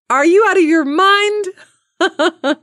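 high, slow, disbelieving friend voice; incredulous friend. Retold or imitated. Caller mimicking a skeptical friend, high and slow. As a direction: play the friend who thinks she's lost it. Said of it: "a friend who might have said to you".

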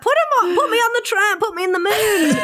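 high-pitched, exuberant self-parody; carefree spender. Pretend. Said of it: "whereas me, I'm like".

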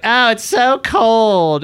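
high, slow, drawn-out complaint; mock whining. Host imitating San Diegans moaning about cold, mock whine. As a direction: mimic a whiner, stretching every word.

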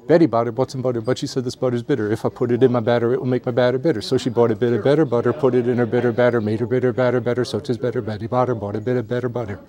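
rattled-off tongue twister, even rhythm; auctioneer's rapid-fire diction drill. Shown as a demonstration. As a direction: run through it briskly without a stumble. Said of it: "you ready for some?".

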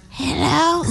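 high, sleepy mock phone hello; half-asleep grandma. Host plays Laura's grandma answering the phone, half asleep. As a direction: answer like a grandma barely awake. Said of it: "like, half asleep".

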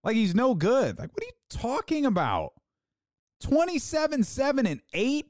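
high-pitched, exasperated disbelief; worked-up anger. Real, not acted. Challenging fans who call him no good, angry disbelief. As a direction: pitch climbing in indignation. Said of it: "I love how I progressively got angrier".

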